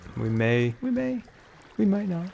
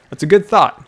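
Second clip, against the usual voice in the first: pitched-up, louder answer to himself; loud high mock voice; 9 semitones higher, 10 dB louder than usual. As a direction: play a second voice, high and loud. Answering self in other voice.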